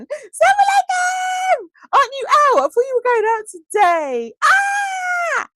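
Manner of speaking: high, loud joyful shrieking; thrilled high squeals; excited squeal. Real, not acted. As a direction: greet a dear friend with delight. Excited greeting to arriving friend.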